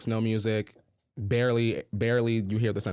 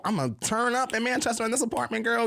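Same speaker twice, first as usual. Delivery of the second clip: high, hyped-up party voice; excited; 12 semitones higher than usual. Retold or imitated. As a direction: gush about turning up in Manchester.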